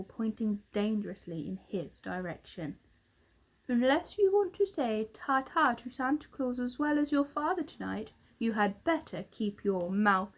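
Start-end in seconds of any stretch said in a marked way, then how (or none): none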